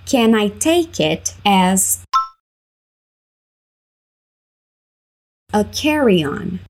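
"Can I take it as" then "a carry-on?" said slowly in two chunks; slow, split into parts. Shown as a demonstration. Teacher splitting the question for students, slow. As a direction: break the sentence into slow pieces. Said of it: "Vamos por partes".

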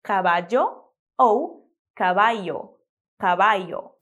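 caballo first cabadjo, then cabayo; two ways of ll. Shown as a demonstration. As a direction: caballo slowly both ways. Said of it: "você pode dizer caballo".

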